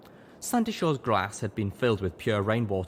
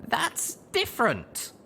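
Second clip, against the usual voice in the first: put-on high, slow, insistent young mare; insisting; slower than usual. A narrator's reading. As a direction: dig in and insist, slowly. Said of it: "I insisted finally".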